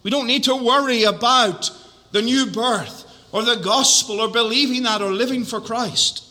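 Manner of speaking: ordinary talk, nothing marked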